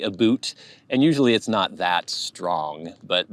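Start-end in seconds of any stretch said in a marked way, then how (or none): 0.0-0.5 "aboot" with an oo vowel; Canadian "aboot". Shown as a demonstration. Showing how Canadians differ, "aboot" with an oo sound. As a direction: say "aboot" for about, Canadian-style. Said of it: "there is a difference. There's the aboot".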